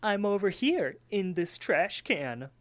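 high, slow cartoon voice; talking pie voice. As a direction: call out as a talking pie, drawing it out. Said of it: "talking pie that's in there".

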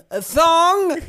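high, halting mock Italian accent. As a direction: ask it in a hammed-up Italian accent. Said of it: "trying to say thong in a, in a Italian accent".

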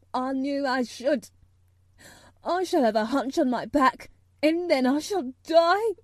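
loud, building hysterical wail; choked out. A narrator's reading. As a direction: wail it as a boy in despair. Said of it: "choked out Colin".

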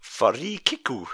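Farikiku said slowly in a mock Japanese accent. Shown as a demonstration. Quiz host giving the next rugby term, in a slow mock Japanese accent. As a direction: draw out the word in a put-on Japanese accent. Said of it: "my best Japanese accent".

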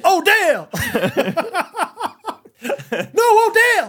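high call breaking into laughter; laughing calls. Retold or imitated. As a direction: shout the name while cracking up. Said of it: "kinda worried about it".

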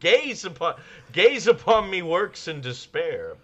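high, loud theatrical proclamation; grandiose mad scientist. Acted. Mad scientist showing off his machine, bombastic declaiming. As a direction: declaim it slowly like a hammy villain.

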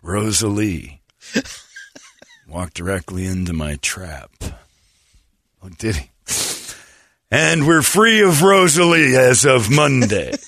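slow, sly scheming bartender; Jack Nicholson-style voice. Retold or imitated. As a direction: savour each word like a smug schemer. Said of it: "sounded like Jack Nicholson".

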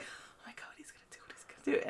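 barely voiced whisper to yourself; anxious whisper. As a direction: whisper it in nervous suspense. Retold anxious thought, looking at him.

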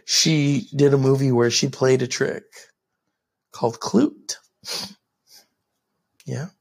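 low far podcast host voice; deep announcer voice. Pretend.

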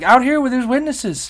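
high, loud comic retort; emphatic insistence. Retold or imitated. Traveler refusing a private pat-down, emphatic. Acts out answer to private screening offer.

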